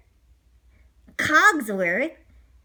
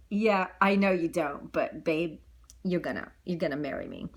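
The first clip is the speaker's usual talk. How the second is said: low, smug, softer bragging; boastful Gaston; 8 semitones lower, 5 dB quieter than usual. Acted. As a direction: show off the jaw and teeth. Gaston insisting to Belle.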